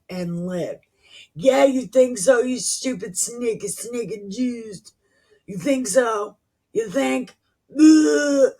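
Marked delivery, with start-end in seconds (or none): 1.4-8.5 higher-pitched, scornful retort; derisive outburst. Real, not acted. Reader heckling the people in the scripture, derisive outburst. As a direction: throw it back at them with disgust. Angry mocking retort at people she reads about.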